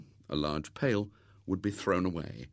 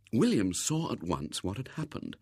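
usual speaking voice

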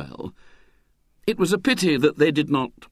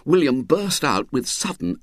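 plain reading voice

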